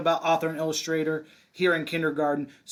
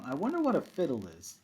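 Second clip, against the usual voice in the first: plain reading voice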